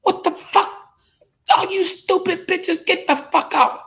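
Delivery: very high, strained mother throwing them out; angry mom. Retold or imitated. Mom orders the girlfriends out of the hospital room, very high furious voice. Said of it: "She said".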